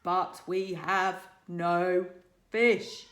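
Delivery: calmly dominate the creature; boy speaking firmly to a dragon, pleasant but firm. A narrator's reading. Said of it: "make his voice sound firm but pleasant".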